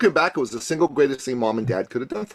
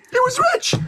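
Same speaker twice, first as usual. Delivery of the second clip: shouted emphasis. Shown as a demonstration. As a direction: blast out rich like a proud son.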